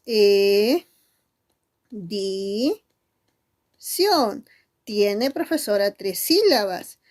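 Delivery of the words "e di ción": "E, di, ción" held, with pauses between; syllable by syllable. Shown as a demonstration. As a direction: count out "E", "di", "ción" as separate syllables. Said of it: "¿Cuántas sílabas?".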